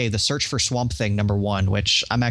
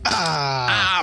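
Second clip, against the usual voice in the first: imitating a brain freeze yelp; short, high mock cry of pain; 7 semitones higher than usual. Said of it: "I can expect an".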